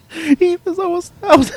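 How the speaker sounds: high giggling; laughing through the words. Real, not acted.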